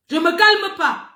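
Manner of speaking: loud, high, slow defiant refusal; very angry. Real, not acted. Streamer too angry to calm down, defiant. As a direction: stretch it out in stubborn anger. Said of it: "Je suis trop fâchée".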